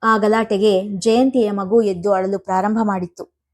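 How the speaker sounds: ordinary delivery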